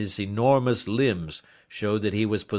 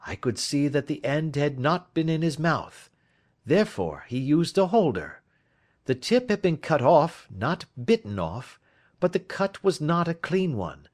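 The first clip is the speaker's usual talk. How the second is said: higher-pitched detective explaining his deductions; a lightly raised voice for the reasoning sleuth; lightly voiced Holmes; 8 semitones higher, 5 dB louder than usual. Detective explaining clues to his friend, lightly voiced Holmes.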